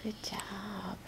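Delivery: gentle whispered good job; whispered praise. Real, not acted. Praising toddler softly.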